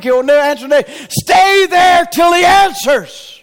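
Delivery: loud, high, slow closing shout; preacher's loud exhortation; preaching shout. Real, not acted. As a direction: hammer the command home. Preaching climax.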